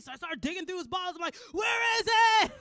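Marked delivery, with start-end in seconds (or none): 1.5-2.5 mock shout. Shouting at a hidden body part to come out. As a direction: holler down at it like calling someone out.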